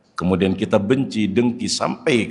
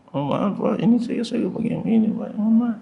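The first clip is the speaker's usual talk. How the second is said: apologetic helper voice; 11 semitones higher than usual. Retold or imitated. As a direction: play someone apologising meekly from up high. Apologetic person acted out.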